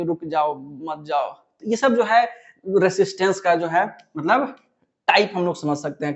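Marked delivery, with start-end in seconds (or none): 0.0-1.3 softly imitating grandma. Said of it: "नानी का काम है कि नहीं रोकना".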